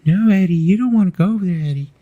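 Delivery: high falsetto imitation; quick, high-pitched put-on warning. [name] warning [name] off the cage, amateur falsetto impression. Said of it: "I don't do that great with [name] voice".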